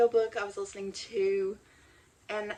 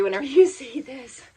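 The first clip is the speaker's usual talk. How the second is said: laughing; 5 semitones higher, 9 dB louder than usual. Real, not acted. Joking about late upload.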